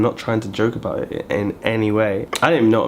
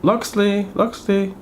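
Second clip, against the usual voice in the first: higher mock call of Loxley's name; mother-in-law calling Loxley, mimicked higher; 8 semitones higher, slower than usual. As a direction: lift it up like calling across the kitchen. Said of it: "she's like".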